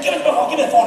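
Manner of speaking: laughing impression of a nagging wife; imitating an insistent wife; wife begging. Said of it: "She said".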